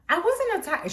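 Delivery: high, lightly imitated crying protest; a touch of mock tearfulness; tearful mom, imitated. Mom correcting children about the attack, lightly imitated tearful protest. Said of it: "well, she's crying".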